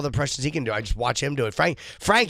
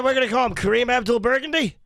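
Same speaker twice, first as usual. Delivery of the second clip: jokey celebrity voice, pitched up; John C. Reilly impression; 9 semitones higher than usual. Comedian doing John C. Reilly for the hosts. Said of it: "it's a masterful impression".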